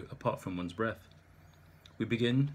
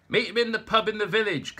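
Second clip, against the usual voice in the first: high, loud shout over noise; shouted over the background din; shouted reply; 11 semitones higher, 7 dB louder than usual. Acted. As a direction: bark the directions down the phone. Pete shouting over phone noise.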